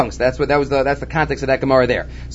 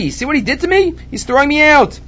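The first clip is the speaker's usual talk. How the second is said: higher-pitched aggrieved protest; complaining; 10 semitones higher than usual. Acted. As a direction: plead like a worker wronged by his boss. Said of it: "Runya came in front of Rava complaining".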